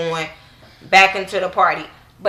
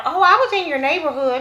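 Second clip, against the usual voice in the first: mock Phaedra; 9 semitones higher than usual. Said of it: "Phaedra, um, tells her".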